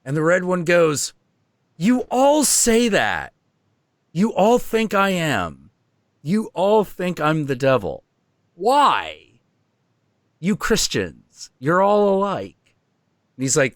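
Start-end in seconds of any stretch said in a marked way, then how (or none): 1.8-12.8 the red one's voice. Retold or imitated. The red one answers Jung.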